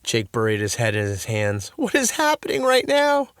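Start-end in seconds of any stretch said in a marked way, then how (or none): 1.8-3.3 high, disbelieving cry; bewildered. A narrator's reading. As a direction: ask in disbelief. Jake buries head.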